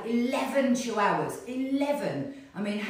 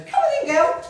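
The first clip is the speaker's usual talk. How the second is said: high, louder sing-song calling; calling the dogs; 10 dB louder than usual. Real, not acted. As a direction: coax the dogs in, high and bright. Calling puppy Matilda in.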